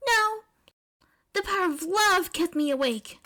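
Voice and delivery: loud high proud declaration; proud May. Acted. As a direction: announce it grandly.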